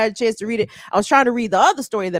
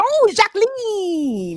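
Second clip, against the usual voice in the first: put-on much higher, slow, drawn-out French-accented "Oh, Jacqueline"; mock French; 10 semitones higher, much slower than usual. Host imitating how he greets Jackie, in mock French. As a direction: croon her name in a put-on French accent. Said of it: "I'm like".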